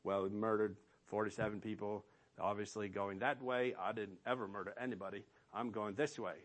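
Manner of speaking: low, fast, soft smug self-justifying voice; mock smug thinker. Preacher voices people who think they're fine, low, fast and smug. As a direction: rattle it off like a smug self-excuser. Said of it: "We'd like to think".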